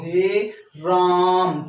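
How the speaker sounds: हे राम stretched very slowly, vowels held; खींचकर हे राम; drawn-out vowel. Shown as a demonstration. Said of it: "थोड़ा बढ़ा के खींच के बोलो".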